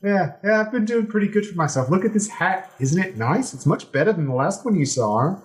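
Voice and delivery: high and fast, proud of his hat; boastful pirate king. Acted. Pirate king bragging about his hat to the crew, high and boastful. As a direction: show off like a peacock.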